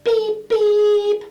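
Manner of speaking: very high, loud cartoon beep beep; high cartoon bird call; road runner beep. Shown as a demonstration. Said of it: "and we say".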